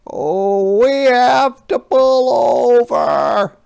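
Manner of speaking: high, loud, slow truck voice yelling over the wind; hollered. Acted. Yellow Truck hollers to Little Red over the storm, high and loud. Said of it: "hollered Yellow Truck".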